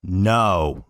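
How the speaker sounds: loud, firm rebuttal; one forceful word of disagreement; emphatic refusal. Real, not acted. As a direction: cut in with a loud, flat-out no. Blurted rebuttal of played clip.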